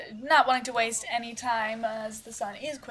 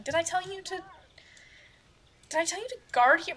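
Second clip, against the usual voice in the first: telling off; 9 semitones higher, faster than usual. Acted. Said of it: "being told off by Mother".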